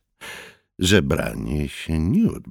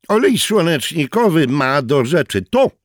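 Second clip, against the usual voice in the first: hostile retort; 10 semitones higher, 7 dB louder than usual. A narrator's reading. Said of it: "postanowiwszy wypowiedzieć nieproszonemu rozmówcy wojnę".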